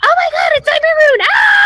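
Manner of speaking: very high, fast excited shriek; Pinkie Pie. Retold or imitated. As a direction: squeal it out like a hyper party pony. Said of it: "Pinkie Pie comes in and".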